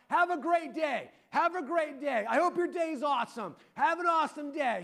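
high-pitched imitation of cheerful yelling; imitated yelling. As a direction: call it out high to passers-by, as if retelling. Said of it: "I heard him yelling out".

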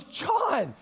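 high-pitched cry of recognition; delighted greeting. Retold or imitated. Acts Mark greeting him again.

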